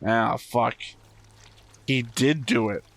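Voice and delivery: ordinary talk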